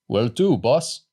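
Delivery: put-on light East European accent from the cab driver. A narrator's reading. Cab driver greets the boys, in a mild East European accent. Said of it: "in an East European accent".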